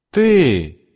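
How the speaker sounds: barking high command; commanding Mrs Rainwater. A narrator's reading. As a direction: snap it out like a bossy matron. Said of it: "скомандовала она". (властный приказ миссис Рейнвотер)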